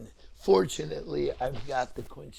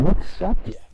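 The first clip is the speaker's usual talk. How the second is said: loud surprised question; 4 semitones higher, 16 dB louder than usual. Retold or imitated. Father startled at daughter studying late.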